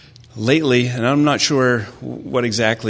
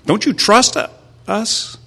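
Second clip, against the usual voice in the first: high-pitched, mock-wounded disbelief; incredulous parent; 13 semitones higher, 5 dB louder than usual. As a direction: reenact a dad baffled at being doubted. Retells incredulous reaction to son.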